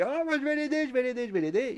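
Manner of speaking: high-pitched hammed-up urgency; frantic high voice. Jey rushing to help his friend, frantic high mock voice. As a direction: repeat it urgently, pitched right up.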